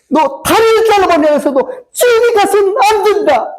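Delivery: very high, tearful-sounding preacher's cry; anguished outcry. Acted. God cries to his child about hell, anguished outcry. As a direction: wail the plea out at high pitch. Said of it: "하나님 그렇게 외치는 거예요".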